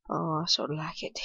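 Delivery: quick sheepish whisper. Real, not acted. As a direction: admit it under your breath. Whispered confession.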